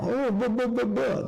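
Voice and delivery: mocking jealous hater. Streamer mocks a jealous hater with high babble. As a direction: squeak out nonsense like a jealous whiner. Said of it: "That's what a jealous fucking walker does".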